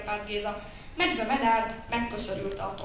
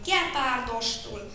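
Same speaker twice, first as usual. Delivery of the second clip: ordinary delivery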